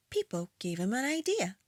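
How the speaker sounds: put-on gentle read-aloud for children; storytime voice. A narrator's reading. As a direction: read it like a bedtime picture book. Reads a picture book aloud.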